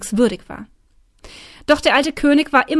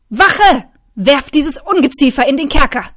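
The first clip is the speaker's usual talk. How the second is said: higher, loud royal roar; 4 semitones higher, 7 dB louder than usual. A narrator's reading. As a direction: bellow for the guards. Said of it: "Dann brüllte er". (brüllender König)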